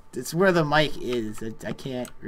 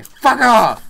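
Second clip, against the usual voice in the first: sudden loud, high yell; angry shout; 10 semitones higher, 15 dB louder than usual. Real, not acted. Player yelling at an attacking creeper, angry shout. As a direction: shout it hard to drive it away.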